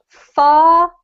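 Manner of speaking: "far" drawn out slowly with a long open "ah"; slow teacher's demo of "far", silent R; model pronunciation. Shown as a demonstration. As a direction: model "far" clearly for students to repeat. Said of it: "I'm gonna read out the top line".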